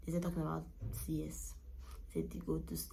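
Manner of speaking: soft worried whisper. Real, not acted. Mother in labour hearing about a C-section, worried whisper. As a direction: whisper it with dread. Nervous telling.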